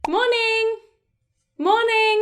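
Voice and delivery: bright high, lilting greeting; friendly high-pitched voice. Shown as a demonstration. Teacher showing how to greet colleagues at work, friendly high-pitched voice. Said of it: "in quite a friendly, high-pitched voice".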